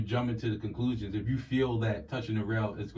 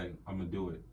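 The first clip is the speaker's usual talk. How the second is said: low, soft deep register; put-on smooth deep voice; 4 semitones lower, 9 dB quieter, faster than usual. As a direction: drop it down quietly, mock-suave.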